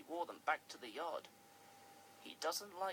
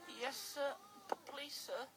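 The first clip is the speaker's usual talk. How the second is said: high, stammering and nervous; 8 semitones higher than usual. Acted. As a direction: stammer out a timid plea. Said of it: "said Percy nervously".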